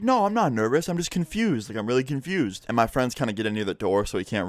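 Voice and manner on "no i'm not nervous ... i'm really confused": higher-pitched, flustered protest easing down; mock a rattled man defending himself; imitated nervous denial. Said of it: "like, really nervous".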